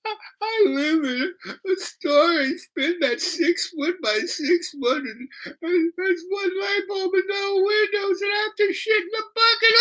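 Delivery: wailing falsetto, words dragged out through sobs; hammed-up crying imitation, halting then rushing; bawling girl. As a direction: blubber it out very high, as if pouring out misery. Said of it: "And she starts bawling".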